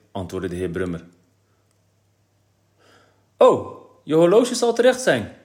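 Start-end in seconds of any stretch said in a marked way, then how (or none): 3.4-5.3 high, loud excited exclamation; bright idea cried out; calling out. A narrator's reading. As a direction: pipe up with a sudden guess. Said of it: "riep Mies".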